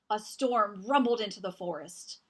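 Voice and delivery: ordinary delivery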